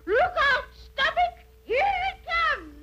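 high, rising cries; gleeful toast. Acted. Witch bracing herself to drink her potion, high and excited. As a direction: psych yourself up to gulp it.

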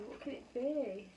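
usual speaking voice

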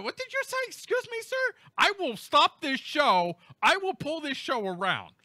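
high-pitched, hammed-up indignation; mock scolding. Host to interrupter, threatening to stop the show, mock scolding. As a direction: threaten like a parent with misbehaving kids.

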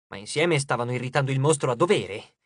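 usual speaking voice

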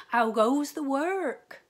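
high, loud, slow bellow from bed; lazy farmer calling. A narrator's reading. Lazy farmer calling from bed to the duck, high loud bellow. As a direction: boom it out like a lazy boss. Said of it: "called the farmer".

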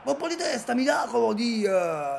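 loud, high, breathless surprise; excited shout. Real, not acted. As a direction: yell it as the keeper saves. Goalkeeper's save, live reaction.

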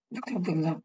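teacher restating the rule to students, soft and low; soft repeat. Shown as a demonstration. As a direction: echo the rule quietly to let it sink in. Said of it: "nguyên tắc đọc là như sau nhé".

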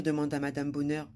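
ordinary delivery, nothing marked

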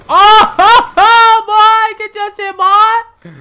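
high, loud hype taunt with a laugh; laughing victory shout. Real, not acted. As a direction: crow at the enemy. Taunting after a kill.

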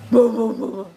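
loud punchy woofs; playful mock dog bark; imitated barking. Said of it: "suara bazoke".

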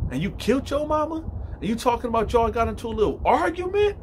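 building to a high, incredulous accusation; indignant confrontation. Retold or imitated. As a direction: act out a wronged inmate's reproach. Grieving inmate confronts killer.

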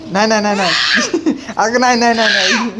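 laughing refusal. Real, not acted.

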